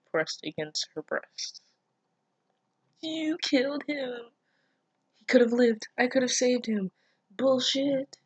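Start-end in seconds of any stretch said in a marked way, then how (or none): 3.0-8.2 high, half-mocking hysterical voice; hysterical woman. Reader voices Shizuka screaming at the killer. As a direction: play the hysterical girl, overblown. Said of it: "She said in hysterics".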